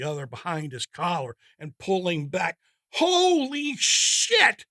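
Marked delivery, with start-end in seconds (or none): 2.9-4.6 high, loud, slow alarmed yell. Acted. Wysocki yelling as he jams the brakes, alarmed. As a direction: scream it as the truck swerves. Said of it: "Wysocki yells".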